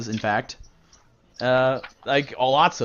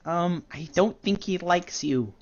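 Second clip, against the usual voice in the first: slow, soft and timid; a small hesitant girl's voice; shy drow girl; 2 semitones higher, 6 dB quieter, slower than usual. Acted. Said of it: "Nene, says".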